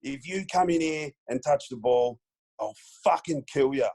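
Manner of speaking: high-pitched, deliberate mock threat; Michael Voss. Star opponent pointing at rookie before the bounce, imitated threat. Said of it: "he points at me, he goes".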